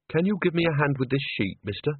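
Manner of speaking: plain reading voice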